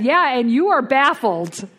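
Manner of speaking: loud, slow, high-pitched comeback; emphatic retort. Retold or imitated. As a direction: throw it back loudly, stretched out. Retort to the baffled sponsor.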